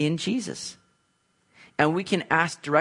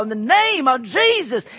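slow, higher Southern preacher drawl, louder; 7 semitones higher, 4 dB louder than usual. Pretend. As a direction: stretch it out in a hammed-up Southern drawl. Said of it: "that good Southern drawl".

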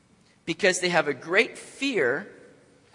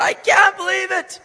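loud, high-pitched freak-out in a mock rant; whining, yelling, complaining; 11 semitones higher, 9 dB louder than usual. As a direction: yell it like an outraged man acting out a meltdown. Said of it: "He's whining, and he's yelling and complaining".

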